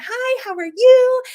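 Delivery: high, loud, slow cheery hello; bright greeting. Retold or imitated. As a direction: greet her big to brighten her day. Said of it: "gonna brighten her day".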